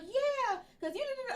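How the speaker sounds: high-pitched imitation of a goading child; instigating little kid. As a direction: egg it on like a small boy. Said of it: "JoJo was instigating a little bit".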